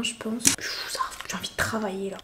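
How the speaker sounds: soft whispered murmur; whispering. Real, not acted. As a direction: breathe it out in a whisper. Whispered aside to camera.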